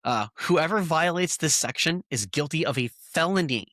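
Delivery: ordinary talk, nothing marked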